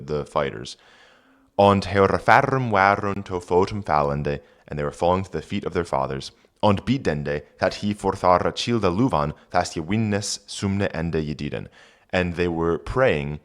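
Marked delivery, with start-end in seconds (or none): 1.6-4.4 put-on reading Old English aloud; careful Old English pronunciation. Shown as a demonstration. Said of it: "reads Old English text". 6.6-11.7 put-on reading Old English aloud; careful Old English pronunciation. Shown as a demonstration. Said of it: "reads Old English text".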